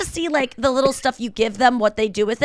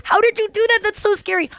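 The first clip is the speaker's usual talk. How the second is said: high, amazed gasp of a question; mock horrified amazement; shocked disbelief; 10 semitones higher than usual. Reacting to a friend's wild story, high shocked disbelief. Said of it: "and I'm like".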